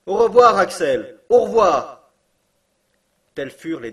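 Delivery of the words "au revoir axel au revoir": loud, high, far-off farewell; loud farewell call. A narrator's reading. Uncle shouts his last goodbye to Axel, loud high.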